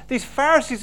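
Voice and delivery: ordinary talk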